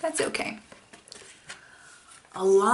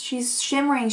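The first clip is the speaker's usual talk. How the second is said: ordinary delivery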